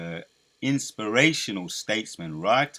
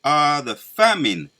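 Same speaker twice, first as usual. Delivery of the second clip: higher, louder, slow solemn proclamation; scripture preaching; 6 semitones higher, 9 dB louder, slower than usual. Acted. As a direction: declaim each verse like a prophet.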